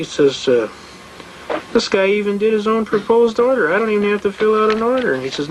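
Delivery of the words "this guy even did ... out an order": much higher-pitched, quickening mock amazement; high, hurried retold glee; marvelling judge. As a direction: imitate an impressed judge rattling it off. Acts out judge marvelling at the filing.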